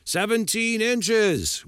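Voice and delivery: slow, high, drawn-out group answer; crowd in unison. Acted. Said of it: "we said in unison".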